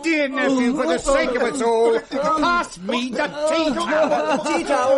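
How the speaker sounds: loud, high, mock-pleading cry for the tea towel; exasperated plea. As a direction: beg theatrically for something to shut him up.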